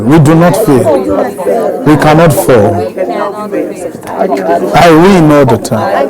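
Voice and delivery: each word drawn out slowly; slow deliberate prayer confession; slow drawn-out declaration. Real, not acted. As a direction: stretch the words out like a solemn vow.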